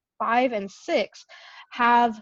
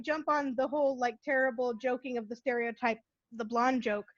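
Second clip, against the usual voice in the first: usual speaking voice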